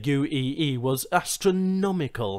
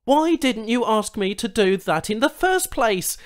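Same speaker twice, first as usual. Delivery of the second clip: exasperated; 10 semitones higher, 6 dB louder than usual. Acted. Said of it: "exasperated breath".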